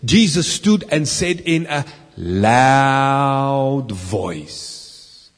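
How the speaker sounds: slowed rereading that sinks; slow emphatic reread. Shown as a demonstration. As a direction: linger on every word. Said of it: "I want to stop right there".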